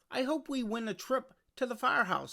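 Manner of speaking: put-on bright hopeful child asking; excited little boy. Arthur hoping, kid voice.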